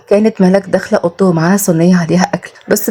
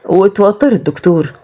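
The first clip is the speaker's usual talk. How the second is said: ordinary delivery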